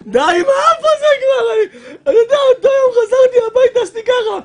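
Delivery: high fast laughter of exasperation; laughing exasperation. Real, not acted. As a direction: burst out laughing, fed up.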